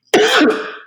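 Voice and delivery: sharp cough; a sudden hard cough. Real, not acted. Coughs before continuing the story.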